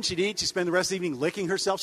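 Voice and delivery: ordinary delivery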